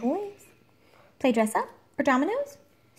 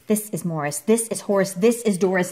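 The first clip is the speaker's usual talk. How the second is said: ordinary delivery, nothing marked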